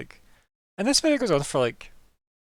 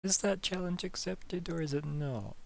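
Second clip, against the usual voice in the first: quiet musing; 4 semitones higher, 9 dB quieter, slower than usual. Acted. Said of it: "He has that look of".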